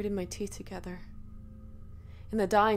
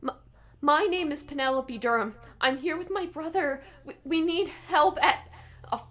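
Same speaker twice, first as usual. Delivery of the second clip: higher, hurried plea for help; panicked caller; 7 semitones higher, 5 dB louder than usual. Acted. Penny tells dispatcher she needs help, panicked. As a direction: rush it out.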